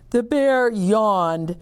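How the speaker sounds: plain reading voice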